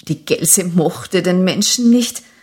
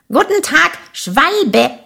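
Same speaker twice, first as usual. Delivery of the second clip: calling out; 7 semitones higher than usual. A narrator's reading. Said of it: "rief die Gelse".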